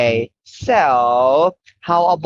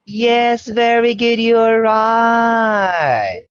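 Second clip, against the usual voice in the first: excited praise; 6 semitones higher, much slower than usual. Real, not acted. Praising child answer.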